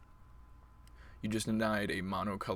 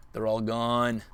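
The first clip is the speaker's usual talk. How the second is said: louder triumphant call; loud gloat; 7 dB louder, slower than usual. Real, not acted. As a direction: announce it with glee. Gloating after wiping lands.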